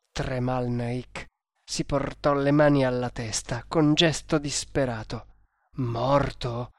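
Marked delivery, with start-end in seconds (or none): 5.8-6.7 "Morto?" asked hushed, as if not believing; a broken, disbelieving question; stunned sob. A narrator's reading. Master hearing of a friend's death, stunned and tearful. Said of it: "singhiozzò".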